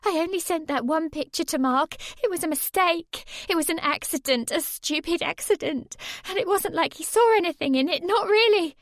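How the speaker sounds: put-on high, pleading girl's voice, rushing; desperately explaining to her boyfriend; frightened girl. A narrator's reading. Narrator voices Sammy pleading.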